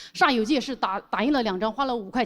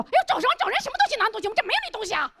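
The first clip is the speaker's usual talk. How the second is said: much higher, scolding shopkeeper's wife; shrewish shop owner's wife; 11 semitones higher, much faster than usual. Retold or imitated. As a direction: squawk at me like a bossy boss's wife. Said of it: "老板娘就飞过来". (老板娘尖声数落我)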